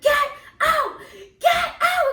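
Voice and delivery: high, loud shout; yelled command. Retold or imitated. Shouting at the ghost in the kitchen, loud yell. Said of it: "I yelled it, like, multiple times".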